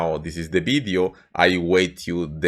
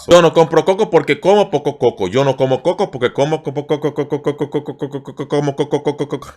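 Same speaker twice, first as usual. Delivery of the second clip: rushed, fading into cocos; fast tongue twister trailing off; 3 semitones higher, faster than usual. Shown as a demonstration. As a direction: lose it and trail off. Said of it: "Let's try one more time".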